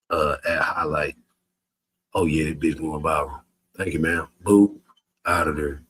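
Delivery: ordinary talk, nothing marked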